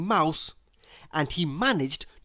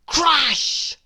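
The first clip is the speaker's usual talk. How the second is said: loud crash; 10 semitones higher, 5 dB louder than usual. A narrator's reading. Narrator sounding the burglar's crash. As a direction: sound word punched high and loud. Burglar trips over string.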